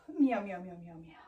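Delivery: string of meows, dropping away sharply; cat breath meows. Shown as a demonstration. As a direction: meow repeatedly on one exhale. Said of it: "cat breath".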